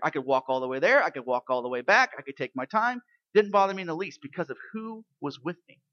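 ordinary talk

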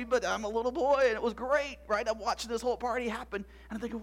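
ordinary delivery

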